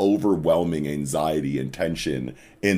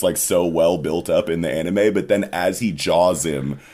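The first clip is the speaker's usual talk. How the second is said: usual speaking voice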